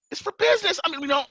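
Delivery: high-pitched retold excuse; flustered protest. Gun owner answering the officers' repeated questions, flustered.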